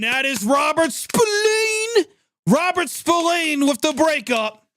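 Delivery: a mock cry over the player's name; changed voice. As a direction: put on a special voice for this player. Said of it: "know why I just changed voice there".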